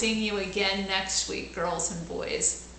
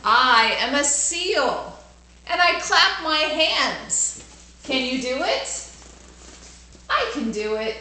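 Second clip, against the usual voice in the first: put-on bright, louder storybook reading for kids; lively read-aloud; 4 semitones higher, 5 dB louder than usual. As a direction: read it out big for the class.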